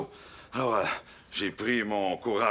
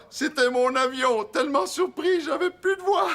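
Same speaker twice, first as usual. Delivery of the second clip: much higher and louder, breathless with surprise; overwhelmed surprise; 4 dB louder than usual. Retold or imitated. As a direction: squeal it, too stunned to speak. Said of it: "C'était l'effet de surprise". (surprise débordante, voix aiguë)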